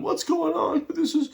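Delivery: high, wailing put-on voice of a crying teacher; mock sobbing lecturer. Vlogger mocking his crying lecturer after the election, high wailing. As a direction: squeak it out like a tearful lecturer falling apart. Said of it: "she's sobbing".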